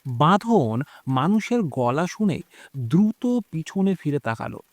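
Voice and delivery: plain reading voice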